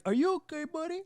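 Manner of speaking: high, concerned mock voice; concerned teammates. Teammates on the bench asking the fallen player, high and concerned. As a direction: voice the worried bench checking on him. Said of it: "The entire bench was like".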